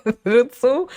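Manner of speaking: acted giggly protest, pitch climbing; laughing to avoid crying. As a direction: laugh through the words, backing away from emotion. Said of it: "I laughed".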